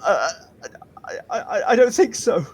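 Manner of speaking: high, terrified stammering; panicked, stuttering squeal; too scared. Acted. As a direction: choke on the first word, then squeak it out. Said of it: "He's too scared".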